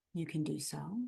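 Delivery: ordinary talk, nothing marked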